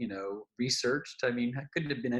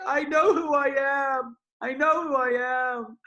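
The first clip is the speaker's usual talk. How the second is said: much higher, louder, slow tearful cry; tearful revelation; 8 dB louder, slower than usual. Retold or imitated. Crying friend realizing herself, high slow tearful exclamation. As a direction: cry it out in overwhelming recognition. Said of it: "she's just got tears streaming down her face".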